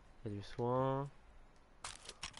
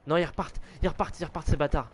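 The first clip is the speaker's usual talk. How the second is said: alarmed; 4 semitones higher than usual. Real, not acted. Enemies landing by helicopter nearby.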